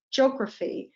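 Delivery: "geography" as "jog-ruh-fee"; silent E. Shown as a demonstration. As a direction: drop the E in geography. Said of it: "E is actually silent".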